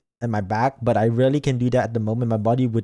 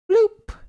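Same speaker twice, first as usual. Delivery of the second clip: high popping bloop sound; a sudden high, loud pop noise; cartoon pop effect; 6 dB louder than usual. Sound effect while eating watermelon.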